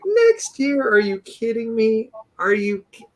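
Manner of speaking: very high, squeaky mock disbelief; incredulous protest. Husband retelling his reply to wife about moving, incredulous squeal. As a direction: protest in a comically high voice, outraged at the delay.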